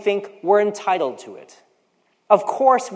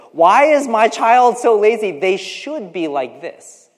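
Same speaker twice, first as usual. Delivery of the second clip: exasperated parent; 6 semitones higher, 8 dB louder, slower than usual. Retold or imitated. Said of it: "you hear the parents talk about their children".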